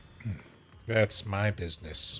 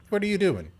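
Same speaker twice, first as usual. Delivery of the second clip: startled high cry of alarm; panicked shout as control slips; 10 semitones higher, 5 dB louder, faster than usual. Acted. Body being taken over.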